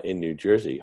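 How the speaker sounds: normal talking voice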